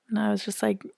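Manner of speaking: ordinary talk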